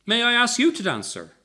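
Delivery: security guard. A narrator's reading. Guard asks the man to dance.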